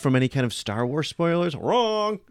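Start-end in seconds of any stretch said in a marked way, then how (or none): 1.6-2.2 a squeaky, gloating "wrong"; playful high-pitched gotcha; gleeful high taunt. Real, not acted. Teasing cut-off about spoilers.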